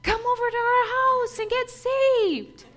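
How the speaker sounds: high, loud call from the porch; screaming invitation. Retold or imitated. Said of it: "she'd scream".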